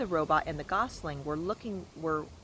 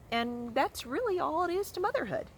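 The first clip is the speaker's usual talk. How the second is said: put-on much higher scolding old goose; fussy goose; 11 semitones higher than usual. A narrator's reading. Goose lecturing the robot on motherhood, high. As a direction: wag a finger like a fussy goose.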